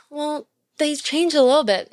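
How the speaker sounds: mock the little voice that makes excuses; mocking inner voice. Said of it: "that little voice in your head that's like".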